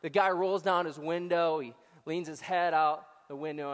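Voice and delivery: ordinary talk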